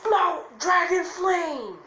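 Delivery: high, loud anime attack shout; battle cry. Acted. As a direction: yell the jutsu name, last words drawn out. Acted anime attack call.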